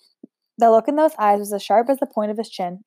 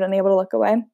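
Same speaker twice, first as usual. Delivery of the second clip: ordinary delivery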